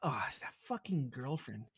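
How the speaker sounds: usual speaking voice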